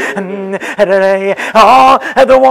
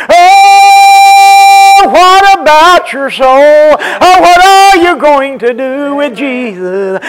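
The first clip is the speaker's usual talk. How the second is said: raised, sung-out altar call; whooped preaching; 5 semitones higher, 6 dB louder than usual. Acted. Pastor calling the congregation to decide about Jesus, whooped. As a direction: call out the question in a preacher's chant.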